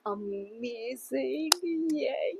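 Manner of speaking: long drawn-out amazement lifting into a yay; delighted savoring. Real, not acted. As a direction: stretch it out, then cheer lightly. Delighted first taste of her coffee.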